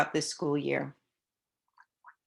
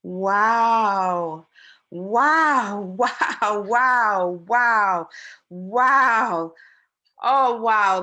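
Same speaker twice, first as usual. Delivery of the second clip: excited; 7 dB louder, much slower than usual. Acted. Child reading excited wows.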